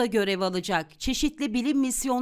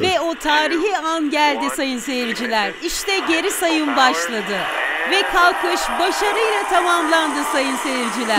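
louder, rising launch call; excited announcer; 4 semitones higher, 5 dB louder than usual. Acted. Announcer telling viewers of the launch, with rising excitement. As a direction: call the liftoff to the crowd.

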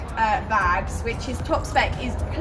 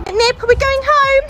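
high, louder happy call to the pony; excited pet voice; 12 semitones higher, 8 dB louder than usual. Real, not acted. Calling pony, going home.

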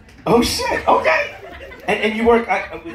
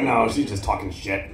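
low slow husband voice; 12 semitones lower, 7 dB quieter, slower than usual. Retold or imitated. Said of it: "And he's like".

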